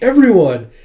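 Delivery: high, loud happy-surprise call; surprised exclamation. Retold or imitated. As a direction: exclaim at seeing the whole group. Yona sees all her friends.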